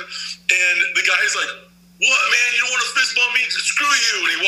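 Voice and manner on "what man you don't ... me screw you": indignant retold outburst; offended guy snapping back; angry. Angry guy refused fist bump.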